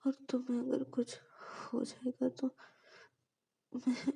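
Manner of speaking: soft, high, halting through tears; stammering while still crying; tearful. Acted. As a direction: Payal clings to Ananya, quiet and tearful. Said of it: "तब भी रोए जा रही थी".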